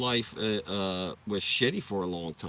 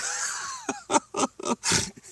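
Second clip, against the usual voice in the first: very high, helpless laughter; real laughter. Real, not acted. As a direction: burst out laughing after screaming. Laughing at own story.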